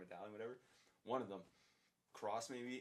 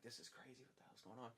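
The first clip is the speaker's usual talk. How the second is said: hushed disbelief; 12 dB quieter than usual. Retold or imitated. Shocked at man coughing blood.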